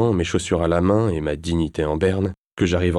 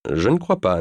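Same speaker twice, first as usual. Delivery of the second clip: usual speaking voice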